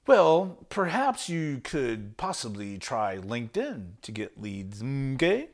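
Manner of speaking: soft, slow and hesitant, each word tentative; wimpy, no bite. Shown as a demonstration. A salesman pitching LinkedIn without conviction, timid and hesitant. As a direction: sound unsure you can help. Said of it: "Like, that could be the tone".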